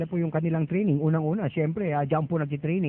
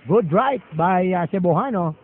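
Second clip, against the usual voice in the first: excited; 4 semitones higher, 7 dB louder, slower than usual. Real, not acted. Commentator reacts to punch.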